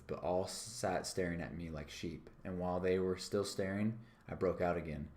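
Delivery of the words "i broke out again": plain reading voice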